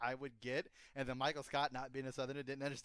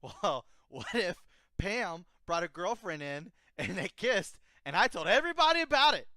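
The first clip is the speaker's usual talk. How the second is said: mock Michael Scott; 4 semitones higher, 9 dB louder than usual. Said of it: "he's all like".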